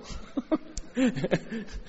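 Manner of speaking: high breathy laugh; a real amused laugh; laughter at his own joke. Real, not acted.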